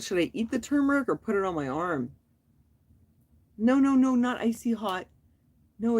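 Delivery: ordinary talk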